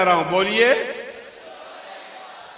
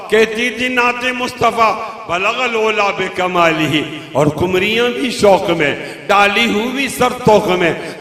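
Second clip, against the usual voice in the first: declaimed verse, a little fuller; poem recital; 5 dB louder than usual. Acted. Preacher reciting a praise poem to the crowd, rhythmic and full.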